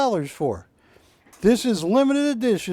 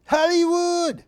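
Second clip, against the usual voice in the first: high, ringing call of Hollywood; movie Hollywood shout; 11 semitones higher than usual. Retold or imitated. Driving to the studio, he hams up the movie's high Hollywood call. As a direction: belt out Hollywood like the film. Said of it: "And I do that loud in my car".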